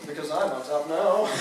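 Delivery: ordinary talk, nothing marked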